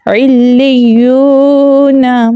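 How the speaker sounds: علی یو نا with the oo held long; stretched madd. Shown as a demonstration. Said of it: "ہم اسے کھینچ کر پڑھیں گے".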